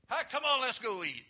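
loud, high holler; sudden hearty shout to come along; calling out. Acted. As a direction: holler it out, pitch dropping at the end. Acts out hearty call to eat.

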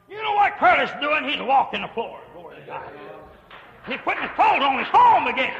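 high, loud preacher's triumph; preaching at full force. Real, not acted. Preacher telling the church of a recovery, loud and high. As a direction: ring it out to the congregation.

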